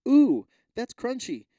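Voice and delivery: slow, high mock "ooh"; drawn-out surprised ooh at a crunchy bite; crunchy surprise. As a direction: act out a startled crunch. Mock bite reaction quote.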